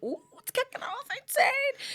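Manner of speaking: high, drawn-out retold squeal; gleeful excitement. Retelling hearing the fight outside, hammed-up excitement. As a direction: relish the drama in a stretched high voice.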